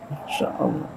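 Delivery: breathy whispered Masya Allah; a hushed whisper of wonder; whispered awe. Acted. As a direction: barely breathe it out.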